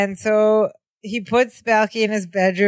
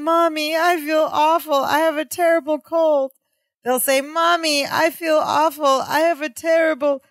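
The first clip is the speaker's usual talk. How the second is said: mock whiny child; 7 semitones higher than usual. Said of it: "emotional act".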